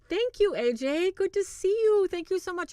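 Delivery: high, loud, gushing greeting; excited thanks. Real, not acted. Host thanking a super chat donor, excited. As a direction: burst out in delighted, high-pitched gratitude.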